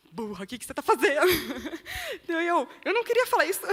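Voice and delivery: talking through real laughter; quick words breaking into laughs; laughing at herself. Real, not acted. Ri de si mesma ao lembrar. (falando e rindo de si mesma)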